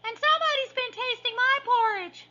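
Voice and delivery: tiny bear squealing very high; baby bear squeak. A narrator's reading. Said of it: "squeaked the baby bear".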